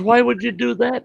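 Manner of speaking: high, loud incredulous yell; high, loud hammed-up outrage; outraged shout. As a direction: shout it at the man with the camel. [name] yells at the scientist.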